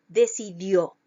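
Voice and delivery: "decidió" with a loud final DIÓ; stress on last syllable. Shown as a demonstration. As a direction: punch the ending of "decidió". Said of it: "llevan el acento en la última sílaba". ("decidió" con fuerza en la última sílaba)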